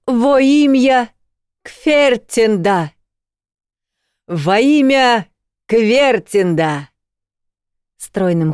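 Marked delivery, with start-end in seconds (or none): 0.1-6.9 slow, raised ceremonial call; solemn proclamation. Acted. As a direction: call it out like a sacred oath. Rector's ceremonial invocation.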